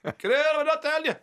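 high-pitched, exasperated dad brush-off; mock-indignant dad, voice jumping high; dismissive dad. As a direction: imitate dad shooing off nosy questions, pitched right up. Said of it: "My dad goes".

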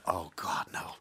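hushed, horrified whisper; dismayed whisper. Retold or imitated. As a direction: breathe it out in dismay. TV crew's dismay, retold.

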